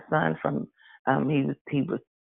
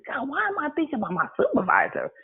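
puzzled; 11 semitones higher than usual. Retold or imitated. Asking God in her thoughts.

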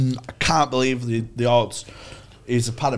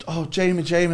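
higher-pitched mock-weepy mother; imitated tearful mum; 6 semitones higher than usual. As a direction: lightly imitate a mum crying over her son. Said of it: "Starts crying her eyes out".

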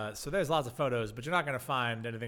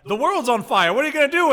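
alarmed reporter; 10 semitones higher, 12 dB louder than usual. Retold or imitated. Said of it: "And reporters are like".

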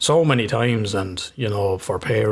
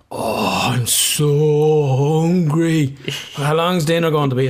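put-on whiny hungry kid; 4 semitones higher, 3 dB louder, much slower than usual. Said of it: "he was giving it that".

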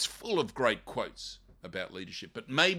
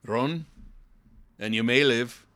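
Wallace reply; 2 semitones higher, 7 dB louder, slower than usual. Retold or imitated. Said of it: "And he says".

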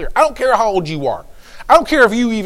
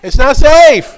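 loud, high exclamation; raised voice, punched hard; emphatic warning; 6 semitones higher, 9 dB louder, much slower than usual. Real, not acted. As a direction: cry out the warning for laughs. Mock warning, repeated louder.

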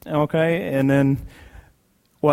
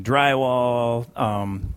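ordinary talk

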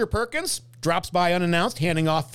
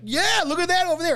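excited; 13 semitones higher than usual. Retold or imitated. Voicing the viewer, excited.

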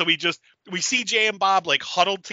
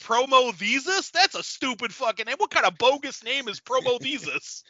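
mocking Jay; 9 semitones higher than usual. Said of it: "He's gonna be like".

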